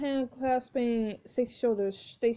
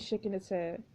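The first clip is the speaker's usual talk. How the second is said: ordinary talk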